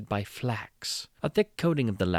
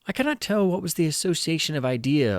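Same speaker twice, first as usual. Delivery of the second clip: ordinary delivery, nothing marked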